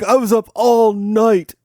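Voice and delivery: high, slow whine of an excuse; whiny student. Retold or imitated. Student whines his excuse to the annoyed teacher. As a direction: drag out the sulky excuse. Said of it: "He's like".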